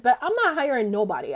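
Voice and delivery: ordinary talk, nothing marked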